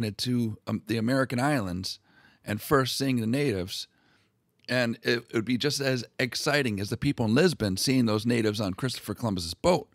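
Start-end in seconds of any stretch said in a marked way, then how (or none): none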